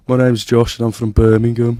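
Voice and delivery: loud, put-on Birmingham accent; Brummie accent. Shown as a demonstration. Birmingham student performing on request, loud Brummie accent. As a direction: lay on the Brummie accent, loudly. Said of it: "Bit of a Brummie accent".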